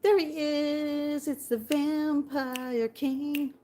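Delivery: high, slow, drawn-out fanfare; excited announcement. Real, not acted. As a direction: herald his arrival, stretching the words. Hails a viewer by nickname.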